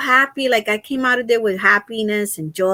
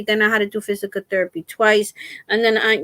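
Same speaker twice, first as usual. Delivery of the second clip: normal talking voice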